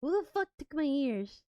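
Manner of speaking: usual speaking voice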